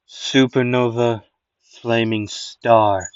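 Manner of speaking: drawn out, very slow move name. Acted. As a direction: stretch every syllable for drama. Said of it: "I got a better way to do that".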